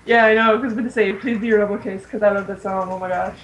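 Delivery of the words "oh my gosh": ordinary talk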